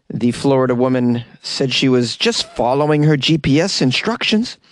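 booming slow news-read voice; news parody read. Acted. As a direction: announce it like a tabloid anchor. Reads a news item.

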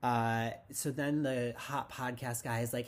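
usual speaking voice

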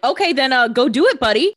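loud, high, mocking send-off; sarcastic cheer; sarcastic go-ahead. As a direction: fling it at him like a dare. Elena mockingly dismissing Stefan.

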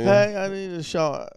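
high, slow put-on girl voice; imitating Marley. Friend asking the bartender for a shot, high and slow. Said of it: "you know how she talks".

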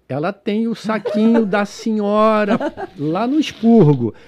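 slow, drawn-out phrasing; measured, stretched-out imitated translation; deliberate retold explanation. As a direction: spell it out carefully for the listener. Translating the reveal to the passenger.

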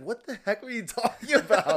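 laughing disbelief; laughing mock outrage. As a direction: blurt it out cracking up. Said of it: "it's like".

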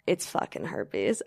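low, slow, soft sinking realization; quiet dread. Retold or imitated. Realizing she has herpes, low and slow. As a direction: let the truth land heavy.

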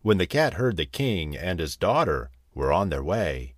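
ordinary delivery, nothing marked

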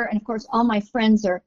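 usual speaking voice

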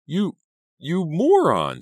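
very high, loud, stretched insult; sputter, then shriek it out; outraged shriek. A narrator's reading. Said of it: "Selena shrieked".

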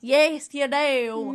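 slow, loud southern drawl; hammed-up southern twang; mock southern accent. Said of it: "you do have a southern accent".

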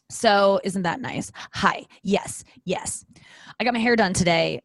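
ordinary talk

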